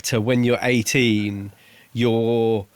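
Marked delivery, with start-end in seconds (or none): none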